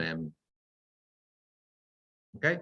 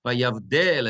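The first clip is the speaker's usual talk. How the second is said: "Vayavdel" with the accent on "del"; stress on last syllable; 4 dB louder than usual. Shown as a demonstration. Teacher showing the accent on the dalet, "Vayavdel" stressed on "del". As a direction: lean on the final "del" of "Vayavdel". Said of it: "the accent is on the dalet".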